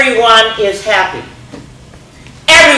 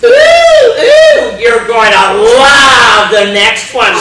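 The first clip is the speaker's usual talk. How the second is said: gleeful squeal; 3 semitones higher, 7 dB louder, slower than usual. Acted. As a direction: squeal with delight. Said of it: "says Ruth".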